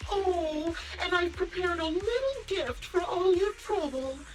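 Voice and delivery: high, playful villain tease; toying with the hero; gleeful taunt. Acted. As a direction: dangle a nasty gift in a high, sweet-talking lilt.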